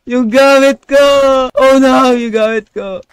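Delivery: loud, high cry of dismay; dismayed yell. Real, not acted. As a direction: wail loudly over lost gear. Loses items after attack. (pasigaw na panghihinayang)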